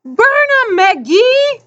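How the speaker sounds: high, ringing holler; one voice rising out of the crowd; lone crowd cheer. A narrator's reading. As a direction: cheer the runner on, high and drawn-out. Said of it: "a single voice separated itself".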